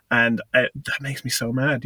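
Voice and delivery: ordinary delivery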